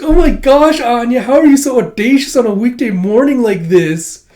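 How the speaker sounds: high and loud, breathless; gushing excitement. Retold or imitated. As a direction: squeal with delight. Becky gushing.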